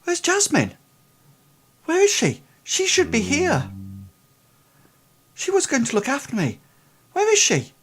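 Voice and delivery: put-on very high-pitched, anxious boy; high, fretful lad, acted; nervous boy. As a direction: plead for the missing carer in a high, lost voice. Game master plays nervous boy.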